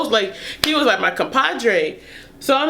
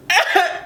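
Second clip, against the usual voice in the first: short laugh; 7 semitones higher than usual. Real, not acted.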